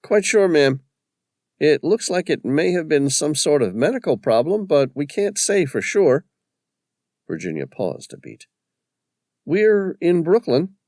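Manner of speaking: lightly voiced paramedic on the phone; character voice. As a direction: break the news gently but plainly. Paramedic delivers bad news.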